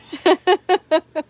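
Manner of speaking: high falling laugh; a loud laugh that trails down; real laugh at the chat. Real, not acted.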